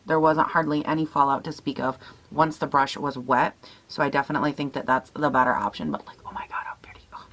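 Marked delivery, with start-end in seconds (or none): 5.9-7.3 very soft awed whisper. Real, not acted. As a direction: breathe it out in quiet awe. Admiring the shade on her lid.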